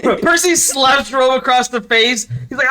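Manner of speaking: ordinary talk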